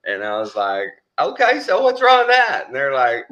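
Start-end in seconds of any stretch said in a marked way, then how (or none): 1.2-2.7 high, loud comeback; mock indignant retort. Patient defends wanting the Bee Gees, loud mock-indignant retort. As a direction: protest loudly as if there's nothing wrong. Retells own retort to companions.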